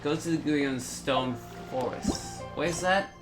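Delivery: normal talking voice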